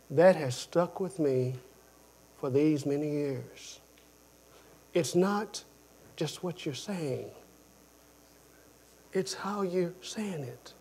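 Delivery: ordinary talk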